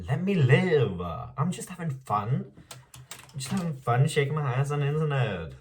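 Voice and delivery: high, exclaimed protest; exasperated plea. Real, not acted. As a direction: plead it high, like fed-up whining.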